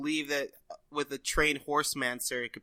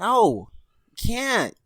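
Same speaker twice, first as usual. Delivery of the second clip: loud refusal; 8 semitones higher, 8 dB louder than usual. Pretend. Refusing running through camp.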